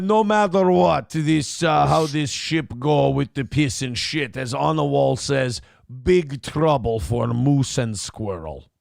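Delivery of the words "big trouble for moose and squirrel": put-on Russian accent. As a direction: ham up a Boris-style Russian villain. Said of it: "you can do a Russian accent".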